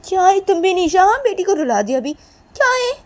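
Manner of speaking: high, loud, heated telling-off; worked-up quarrelling voice; angry reproach. Acted. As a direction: demand the baby back indignantly. Blaming Nisha for baby's crying.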